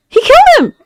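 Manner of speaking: high, startled cry; shocked outcry. Real, not acted. Viewer reacting to the killing, high shocked cry. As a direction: blurt it out in shock.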